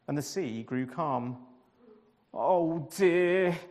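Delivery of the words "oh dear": groaning. A narrator's reading. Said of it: "Jonah groaned".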